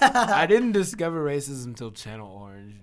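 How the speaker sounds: loud teasing quote through laughter; mock him, cracking up. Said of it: "You said".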